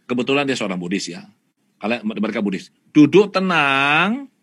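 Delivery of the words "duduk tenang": slow calm command. Retold or imitated. Instructing meditation.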